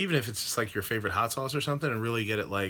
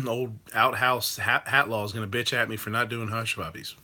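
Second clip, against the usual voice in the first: normal talking voice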